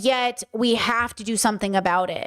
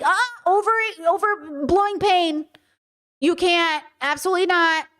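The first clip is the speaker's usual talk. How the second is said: emphatic objection; 6 semitones higher than usual. Retold or imitated. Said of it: "And I'm saying".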